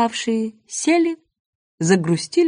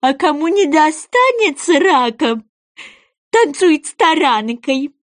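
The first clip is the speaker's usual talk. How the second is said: high, loud creature voice, drawn out; eager goby; 5 semitones higher, 3 dB louder, slower than usual. Acted. Goby calls the sea animals to line up, eagerly. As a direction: call out the list high and insistent.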